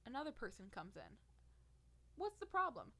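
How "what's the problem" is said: a higher, louder nurse asking what's wrong; brisk staff voice. Retold or imitated. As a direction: play the staff member who just walked in. Voicing new staff member.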